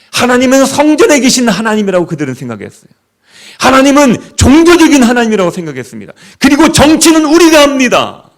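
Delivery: high, loud, building declamation; forceful declaiming. Retold or imitated. Preacher voicing the Israelites' mistaken belief, forceful and rising. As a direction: build to a ringing, high-pitched proclamation.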